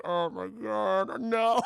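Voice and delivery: slow, stretched-out groan of dismay; drawn-out, hammed-up mortification; mortified wife. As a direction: imitate a wife dying of embarrassment. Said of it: "so fucking mortified".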